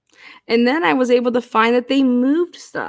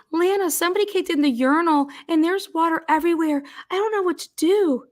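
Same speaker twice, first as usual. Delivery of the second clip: high, rushed mock-panicked phone call; panicked employee; 5 semitones higher, faster than usual. Staffer calls the boss at night about flooding, mock-panicked. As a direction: imitate a flustered employee pleading for help.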